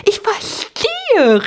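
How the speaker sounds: very high, drawn-out squeak; tiny voice lighting up; sudden understanding. A narrator's reading. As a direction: cry out slowly as it dawns on you. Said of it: "Doch dann hellte sich sein Gesicht auf".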